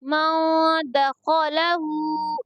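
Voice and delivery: "मौ द खौ ला हु" slowly, a held nasal after म; "मौ द खौ ला हु" recited with a drawn-out final हु; hidden nun. Shown as a demonstration. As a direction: chant "मौ द खौ ला हु" with nun concealed before द. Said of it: "नून की आवाज़ को छुपा कर पढ़ेंगे".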